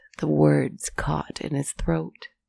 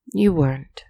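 sad remark. A narrator's reading. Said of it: "she merely remarked sadly".

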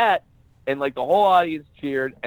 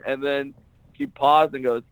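ordinary talk

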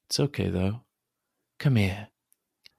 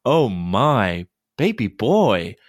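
louder, higher giggly excitement; playful tickling; 4 semitones higher, 6 dB louder than usual. Acted. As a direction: giggle and squeal through the tickling. Tickle game, laughing.

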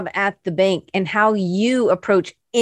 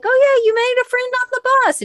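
much higher, fast, airy put-on voice; mock cheerful self; faster than usual. Host mimicking her own misremembering, high and quick. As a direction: play your past self, bright and clueless.

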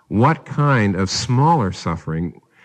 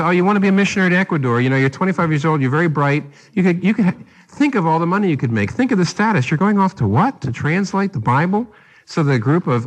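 higher, faster, mock-pleading parent; imitating worried parents; 6 semitones higher than usual. Parents pleading with their missionary son: higher, fast mock-worry. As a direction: talk him out of it like a fretting parent. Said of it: "parents come to the missionary and say".

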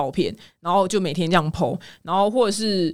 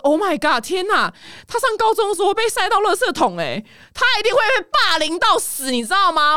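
shocked friend; 10 semitones higher than usual. Retold or imitated. American friend hearing about baby accounts, high shocked outcry. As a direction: recoil in horror at the idea. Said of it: "我那个美国朋友讲什么".

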